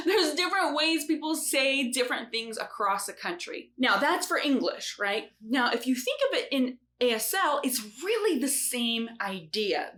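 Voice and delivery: usual speaking voice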